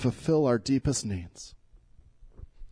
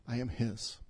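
much softer, lower and inward; soft echo; 5 semitones lower, 11 dB quieter than usual. Real, not acted. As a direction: say it quietly like a confession. Pastor echoes the congregation's answer.

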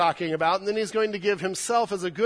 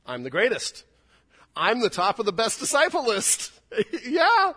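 boastful Peter; 6 semitones higher, 7 dB louder than usual. Acted. Preacher voicing boastful Peter.

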